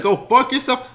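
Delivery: high, loud retelling of the insult; loud mock retort. [name] replaying Spider's insult, loud and high. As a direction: fling the line back like a wiseguy. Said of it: "and a week later you said".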